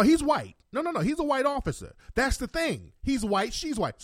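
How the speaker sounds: usual speaking voice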